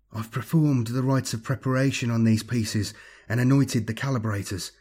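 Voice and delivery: plain reading voice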